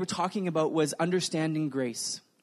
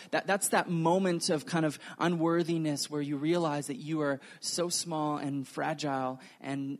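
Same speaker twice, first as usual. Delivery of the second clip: ordinary delivery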